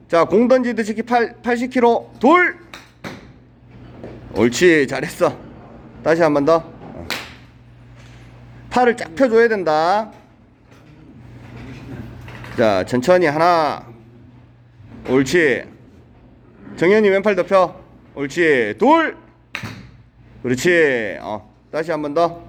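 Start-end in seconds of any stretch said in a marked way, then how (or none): 2.2-2.6 sharp, high, loud count; barking coach count. Real, not acted. Coach barks count on throw. 18.8-19.2 barking coach count. Real, not acted. Coach barks count on throw.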